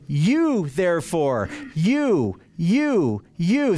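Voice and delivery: slow, drawn-out "You", repeated as separate beats; every "you" stretched and set apart; emphatic you. Retold or imitated. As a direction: hammer each "you" on its own, long and deliberate. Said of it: "he is emphatically saying".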